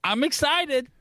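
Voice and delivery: high-pitched, over-keen excitement; exaggerated excitement. Shown as a demonstration. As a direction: sound wildly excited, laying it on. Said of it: "do I sound excited?".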